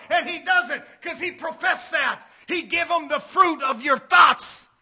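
loud, punched sermon shout; big pulpit voice, drawn out on the last phrase; forceful preaching. Real, not acted. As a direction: preach it hard to the congregation.

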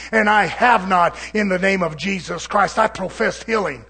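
loud, driving sermon delivery; full-force preaching. Real, not acted. Preacher professing healing over cancer to the church, loud forceful preaching. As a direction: proclaim it loudly, phrase after phrase.

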